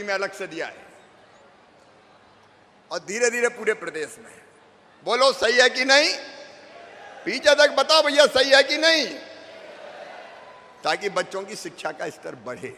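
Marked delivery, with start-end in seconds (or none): 5.1-9.1 loud call for agreement; rallying question. Real, not acted. Leader asking the whole crowd to agree, rallying question. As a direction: project it to the back rows.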